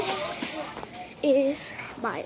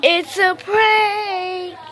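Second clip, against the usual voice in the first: loud; 6 semitones higher, 10 dB louder, much slower than usual. Real, not acted. Reveals prank.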